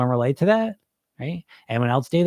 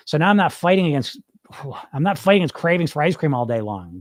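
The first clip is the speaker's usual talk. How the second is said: ordinary talk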